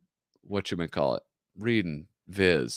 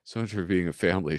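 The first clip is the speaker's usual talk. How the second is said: ordinary delivery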